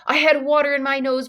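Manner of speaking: usual speaking voice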